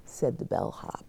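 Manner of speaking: ordinary delivery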